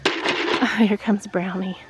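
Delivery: ordinary talk, nothing marked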